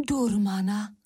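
understated narrated cry of dread. A narrator's reading. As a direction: exclaim the witch's name, mildly. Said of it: "Vykřikly sestry hašteřilky".

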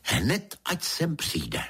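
high, urgent order without shouting; narrator's mildly exclaiming lady; exclaimed command. A narrator's reading. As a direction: cry out the summons, pitched well up. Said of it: "vykřikla paní".

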